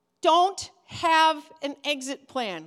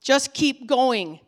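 ordinary delivery

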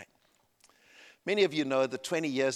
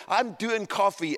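slow, higher-pitched "I'm doing coffee"; proud retold boast; 6 semitones higher, 4 dB louder, slower than usual. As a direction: announce it like the coolest dad. Retold proud boast to his son.

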